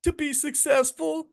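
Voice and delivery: dad voicing his daughter's high, slow answer; imitating daughter. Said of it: "And she said".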